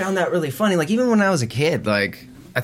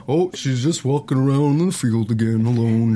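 talking cow; 2 semitones lower than usual. Retold or imitated. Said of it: "the cows are something like".

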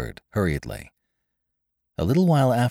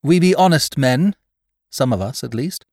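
cold voice; 7 semitones higher, 4 dB louder than usual. A narrator's reading. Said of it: "the cold voice of David Spry".

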